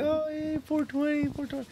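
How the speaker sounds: put-on high, dopey stoner-kid voice; goofy kid chanting four twenty; a high silly mock of middle school stoners. Mocking stoner kids at school.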